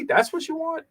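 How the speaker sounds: mock disbelief at the crowd; incredulous. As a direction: pitch jumps way up in disbelief.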